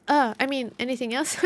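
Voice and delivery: ordinary talk, nothing marked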